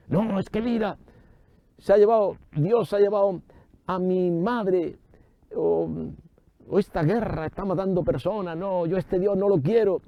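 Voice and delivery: mock lament. Preacher mimics a bereaved believer rejecting God, mock lamenting. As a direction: imitate a grieving complainer in a high voice.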